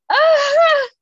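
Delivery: high loud squeal of delight; short high delighted shriek; fangirl scream. Retold or imitated. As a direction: squeal like a swooning fan. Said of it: "And I'm just like".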